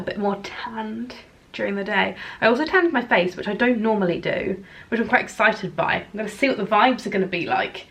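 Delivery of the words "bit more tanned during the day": half-whispered little aside. Real, not acted. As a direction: drop to a whisper as if confiding. Aside while tanning.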